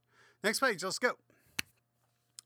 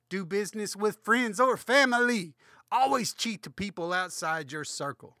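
mock advice; 6 semitones higher, 8 dB louder than usual. Mock sermon advice to kids.

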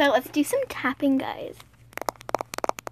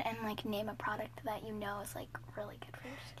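very soft fast whisper; 5 semitones lower, 21 dB quieter than usual. Real, not acted. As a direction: whisper it quickly. Whispering question.

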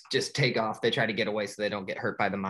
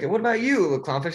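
higher and louder, a bright prodding question; chirpy group leader; 7 semitones higher, 5 dB louder, much faster than usual. Retold or imitated. Sharks turning to Marlin, chirpy prodding. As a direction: sing out the question to the new guy.

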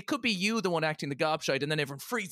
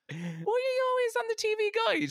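puzzled dad voice. Retold or imitated. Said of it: "This guy just is like".

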